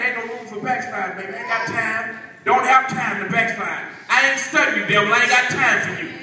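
usual speaking voice

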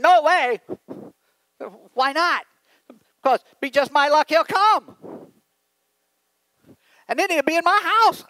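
high, loud mock-outraged wife; wife's loud protest. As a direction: act out an exasperated wife objecting. Wife objects to inviting the President.

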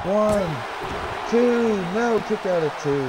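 high, excited play-by-play calls; wrestling commentator. Acted. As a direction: call the big moves like a ringside announcer. Lively match play-by-play.

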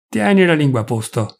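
usual speaking voice

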